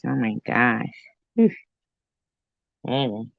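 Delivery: usual speaking voice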